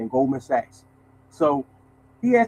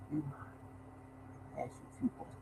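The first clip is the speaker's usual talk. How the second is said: Dracula voice; 4 semitones lower, 25 dB quieter, faster than usual. Retold or imitated. Vice president greeting the mailman, in a hushed Dracula voice. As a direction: lean in and purr it like a count. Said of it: "Told you he talked like Dracula".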